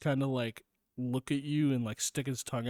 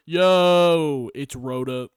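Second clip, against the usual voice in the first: goofy character voice; 2 semitones higher, 12 dB louder, much slower than usual. Pretend. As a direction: shout it like a cartoon character. Voicing character greeting.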